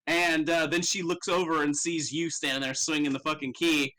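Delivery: ordinary delivery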